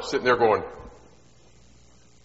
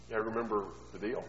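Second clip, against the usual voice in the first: usual speaking voice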